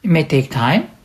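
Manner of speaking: ordinary talk, nothing marked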